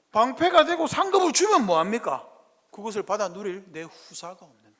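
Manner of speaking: Abraham throwing up his hands at God; high loud exasperated question to God; exasperated protest. Acted. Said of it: "아브라함 뭐라고 그랬죠?".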